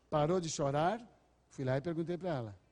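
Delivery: normal talking voice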